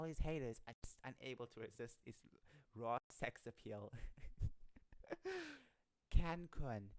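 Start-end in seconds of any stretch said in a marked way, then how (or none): 4.0-5.6 high burst of laughter. Real, not acted. Reader amused by the article, high laughing burst. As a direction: crack up mid-read.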